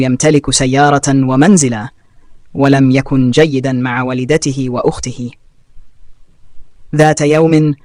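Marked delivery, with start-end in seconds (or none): none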